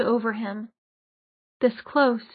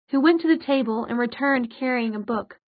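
plain reading voice

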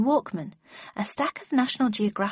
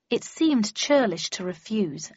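ordinary delivery, nothing marked

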